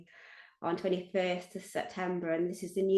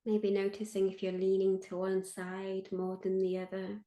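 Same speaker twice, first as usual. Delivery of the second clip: guided meditation; 3 dB quieter than usual. Acted. Meditation leader invites listeners to feel held, guided meditation. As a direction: lead listeners gently inward, leaving space after each phrase.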